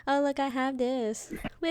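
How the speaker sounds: mock a goofy excited fan; a playful hint of a giddy kid showing off; mocking a silly fan. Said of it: "Not like".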